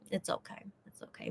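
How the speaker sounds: very soft whisper; barely audible, whispered reassurance; whispered self-consolation. Real, not acted. Consoling herself over jealousy.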